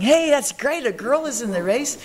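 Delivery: imitating an excited crowd, pitched high; excited onlookers. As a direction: call it out like a delighted spectator, voice lifted. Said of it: "they were very excited".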